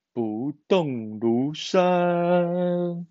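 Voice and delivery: very slow, proud drawn-out words; slow boastful. Acted. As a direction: boast it grandly and slowly. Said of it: "青蛙说".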